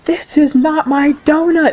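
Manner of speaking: put-on squeaky kid yelling his complaint; imitating yelling kid. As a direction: play the indignant little kid. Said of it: "start yelling at me, saying".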